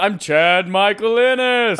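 booming, high, slow name callout; hammed-up late-night show intro call; SNL announcer voice. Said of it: "S N L voice".

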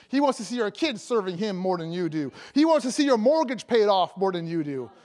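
ordinary delivery

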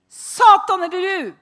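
loud, high rebuke; loud, high challenge to Satan in the bedroom; bold challenge. Retold or imitated. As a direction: confront the devil head-on. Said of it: "Så sier jeg".

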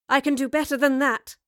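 loud, high, smiling boast; smiling confidence. A narrator's reading. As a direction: answer with cheeky confidence. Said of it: "Jane said with a smile".

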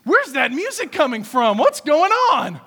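loud, high, startled calling out from the field; a tired farmhand suddenly hearing a party and shouting to find out; startled older son. Acted.

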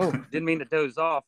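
high, hammed-up surprise at being caught napping; cheerful fake wake-up greeting to the gawking visitors; mock waking up. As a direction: play it like you just startled awake. Acting himself waking in enclosure.

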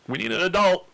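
high-pitched put-on kid voice; jokey, squeaked plea for help; mock helplessness. Mock helpless reaction, retold.

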